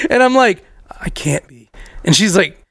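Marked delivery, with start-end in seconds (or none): 1.0-1.7 voiceless, hushed retold disbelief; imitating a panicked whisper; stunned whisper. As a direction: whisper as if horrified. Stunned high self, retold.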